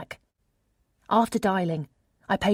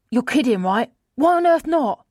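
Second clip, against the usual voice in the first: loud, high-pitched disbelief; acted incredulous protest, pitched up; 6 semitones higher, 7 dB louder than usual. A narrator's reading. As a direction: rise to an exasperated, loud demand. Friend reacts in disbelief on phone.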